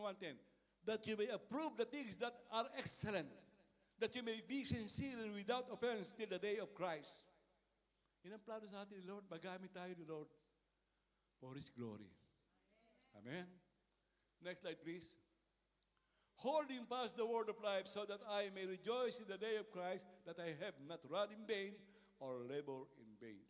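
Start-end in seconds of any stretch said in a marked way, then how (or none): 0.8-7.2 slow, solemn Bible reading; scripture reading. Acted. Reads scripture verse aloud. 16.4-23.4 slow, emphatic verse reading, louder at the start; scripture reading. Acted. Reads scripture verse aloud.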